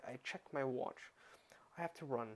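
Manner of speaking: ordinary delivery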